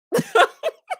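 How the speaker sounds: very high, rising laugh; high shriek of laughter. Real, not acted. Host laughing that Steve has no job, high shriek. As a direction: howl at the joke.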